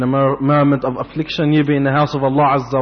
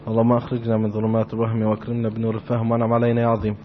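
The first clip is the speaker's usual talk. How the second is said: low, quick, soft recited invocation; murmured formulaic prayer, pitched low; opening Arabic prayer; 6 semitones lower, 6 dB quieter, much faster than usual. Real, not acted. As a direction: recite the opening supplication rapidly and quietly.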